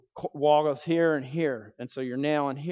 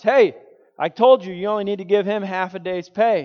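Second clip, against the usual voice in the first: indignant outburst; 6 semitones higher, 9 dB louder than usual. Retold or imitated. Eric argues with foreman.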